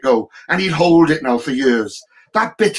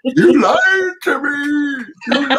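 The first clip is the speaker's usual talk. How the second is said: put-on high, slow wail of a wounded boy; hammed-up hurt child crying out; betrayed son; 12 semitones higher, 3 dB louder, much slower than usual. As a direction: cry out like a child who has just been lied to. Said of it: "he looked at me like".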